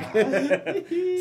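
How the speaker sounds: real laugh, high and bright; high burst of laughter. Real, not acted. Laughing at own story.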